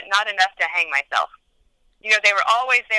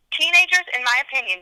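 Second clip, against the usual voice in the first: normal talking voice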